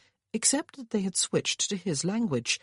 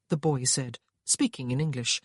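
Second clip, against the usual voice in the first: ordinary delivery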